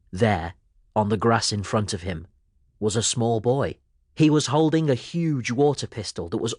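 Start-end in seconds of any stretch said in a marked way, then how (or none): none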